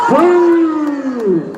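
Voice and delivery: boo it like an angry rally crowd. Real, not acted. Crowd jeers at UN agenda.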